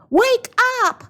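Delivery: high, loud panicked shout; Mama rousing the family, panicked yell; urgent yell. Acted. Mother waking May about Nian, frantic high shout. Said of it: "Mama yelled".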